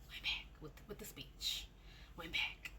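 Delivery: ashamed half whisper; admitting she went back, whispered. Real, not acted. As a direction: breathe it out like a confession.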